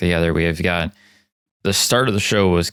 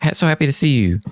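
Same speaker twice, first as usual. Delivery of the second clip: higher, beaming voice; happy greeting; 7 semitones higher, faster than usual. Retold or imitated. Kentaro greeting his sibling, bright and overjoyed. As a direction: sound delighted to see them. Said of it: "it's very much like".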